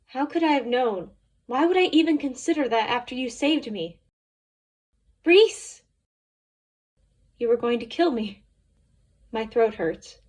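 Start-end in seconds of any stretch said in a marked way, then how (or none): 5.2-5.8 sharp high yell of her name; shouting a name. Acted. Calling out to panicking Reese, a loud yell.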